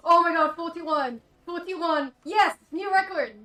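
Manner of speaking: thrilled, punchy outburst; excited celebration. Real, not acted. Streamer hits a new record, excited. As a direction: burst out with the news of a record.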